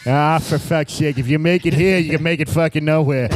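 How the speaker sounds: grumbling mock Boston accent. Comic as a Bostonian who hates New York, mock Boston accent. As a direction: play a disgusted Bostonian, accent to the fore. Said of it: "the Boston people be like".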